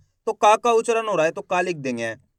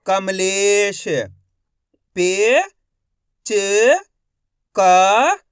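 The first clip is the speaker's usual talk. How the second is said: ordinary talk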